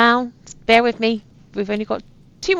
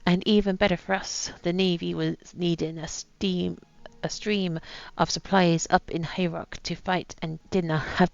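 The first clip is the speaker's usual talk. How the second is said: put-on light pirate accent reading a captain's journal; lightly voiced pirate accent, a gruff old sea captain; soft reading in a light pirate accent; 5 dB quieter than usual. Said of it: "with an accent I've decided to put on".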